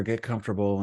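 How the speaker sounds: normal talking voice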